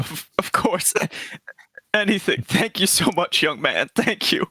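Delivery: a doting granny overcome with gratitude; grateful grandmother. Acted. Player voices grandmother character.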